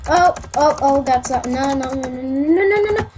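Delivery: rising into a panicked yell. Real, not acted. Close fight in the game.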